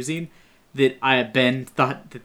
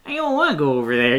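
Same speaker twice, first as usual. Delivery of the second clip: mock little kid voice; 3 semitones higher, 6 dB louder, faster than usual. Mocking the actress in a quick kid voice. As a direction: play a little kid refusing. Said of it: "she sounds like she's doing a little kid voice".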